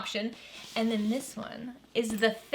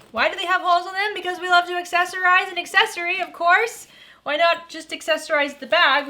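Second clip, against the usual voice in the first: playful mock question; 7 semitones higher, 5 dB louder than usual. Real, not acted. Joking about accessorizing an accessory.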